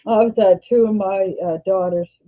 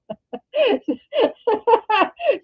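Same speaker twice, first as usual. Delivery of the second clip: high laugh through tears; 13 semitones higher than usual. Real, not acted. Teary vlogger breaks into laughter.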